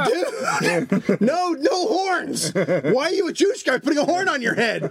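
high-pitched, mock-horrified protest; hammed-up dismay; exasperated retold plea. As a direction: plead in comic disbelief, voice pushed high. Comic retold plea to horn blower.

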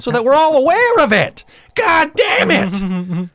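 loud, very high mock-furious yelling; exasperated outburst. Comedian berating audience that missed the point, exasperated shouting. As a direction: yell it high, dragging out the curses.

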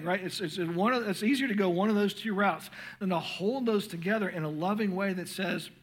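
ordinary talk, nothing marked

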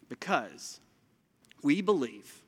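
ordinary delivery

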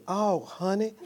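calm the wife down with a soft "Oh, honey"; soft answer. Retold or imitated. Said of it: "A soft answer".

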